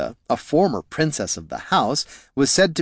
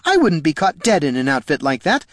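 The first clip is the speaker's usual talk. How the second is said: higher-pitched, offended retort; haughty protest; 5 semitones higher, 3 dB louder than usual. Acted. Double disowning Jelana's outfit, haughty protest. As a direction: protest as if insulted by the comparison. Acted quarrel between the doubles.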